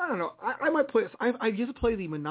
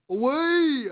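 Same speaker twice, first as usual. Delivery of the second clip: loud, higher heroic command; shout; 8 semitones higher, 5 dB louder than usual. Pretend. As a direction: call it out like releasing a bird. Sending off the pretend falcon.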